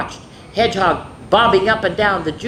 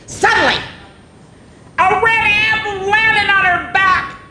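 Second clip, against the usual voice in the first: high, loud startled narration; sudden surprise; 14 semitones higher, 6 dB louder than usual. A narrator's reading. Reading the apple drop to children, high startled shout. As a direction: make the kids jump with the apple landing.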